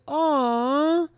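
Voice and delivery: put-on high, loud cooing aw at a baby bump. Reaching for a friend's belly, a high cooing aw. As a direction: coo it like greeting a baby.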